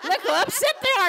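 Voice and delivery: high, laughing glee at the readers' pain; gleeful laughing. Retold or imitated. Sarah gloating over upset readers, laughing and high. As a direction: cackle with delight through the words. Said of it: "And Sarah was like".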